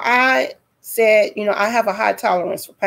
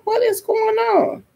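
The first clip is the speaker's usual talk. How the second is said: high-pitched retold bewilderment; bewildered alarm; 12 semitones higher than usual. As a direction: ask it high, in disbelief. Reenacts her bewildered reaction.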